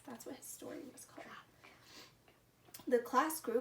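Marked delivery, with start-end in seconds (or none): 0.1-1.3 quick whisper. A narrator's reading. Aside to listeners.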